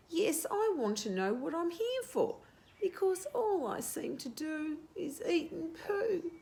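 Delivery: high, blurting bird voice; blurted out. Acted. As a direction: blurt it out in a rush. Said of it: "Cobber blurted out".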